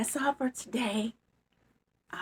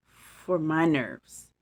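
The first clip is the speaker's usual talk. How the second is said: slow mock serious; 3 semitones lower, much slower than usual. Mouse repeats Bear.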